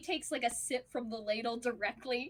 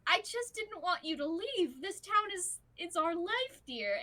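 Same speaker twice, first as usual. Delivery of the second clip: higher-pitched, lightly acted plea; earnest pleading; 6 semitones higher than usual. Husband explaining himself to worried wife, earnest plea. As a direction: plead your case, settling on the last word.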